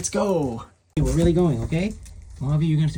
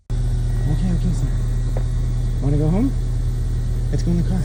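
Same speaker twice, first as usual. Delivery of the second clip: higher, louder, sing-song coaxing; coaxing pet talk; 4 semitones higher, 5 dB louder than usual. Real, not acted. Owner coaxing the dog into the car, bright pet talk. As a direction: coax the dog to come along.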